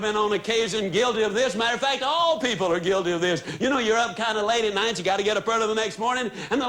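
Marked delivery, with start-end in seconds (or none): none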